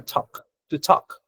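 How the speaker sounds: "Talk" said tok with no L; "To talk" clipped to tok; silent L in talk. Shown as a demonstration. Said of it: "Se pronuncia como talk".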